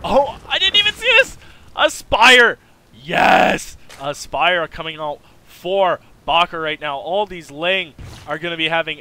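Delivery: sudden high, loud surprise; surprised shout. Real, not acted. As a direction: blurt it out, caught off guard. Surprised by a new Spire.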